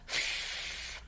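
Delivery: f as a long voiceless hiss; f sound. Shown as a demonstration. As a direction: teeth on the lip, blow f. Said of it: "teeth on our bottom lip".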